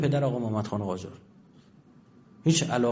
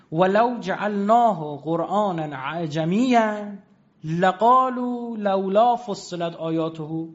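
slow, chanted recitation of the verse, higher than his talk; Quran recitation; 5 semitones higher, much slower than usual. Acted. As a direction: intone the Arabic verse slowly, like tajwid.

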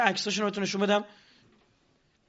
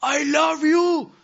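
loud, high, very drawn-out shout; a student in the crowd shouting up at the speaker; shouted I love you; 8 semitones higher, 7 dB louder, much slower than usual. Retold or imitated. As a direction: yell it out, carried away by the moment. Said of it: "بلند شد داد زد".

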